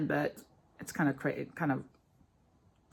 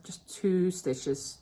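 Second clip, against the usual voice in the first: normal talking voice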